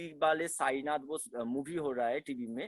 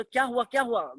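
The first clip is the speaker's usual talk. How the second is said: alarmed; 8 semitones higher, 8 dB louder than usual. Retold or imitated. Alarmed son rushing to mother.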